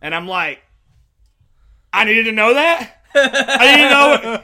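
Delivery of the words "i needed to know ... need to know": loud, high-pitched disbelief; hammed-up indignation, pushed loud and high; incredulous outburst. Comedian replaying his reaction to the driving test, incredulous.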